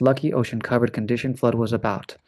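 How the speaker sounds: "Lucky ocean covered condition flood was about" fast, x1.6; as quickly as possible. Shown as a demonstration. Teacher reading the practice words quickly. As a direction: race through the list. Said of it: "as quickly as possible".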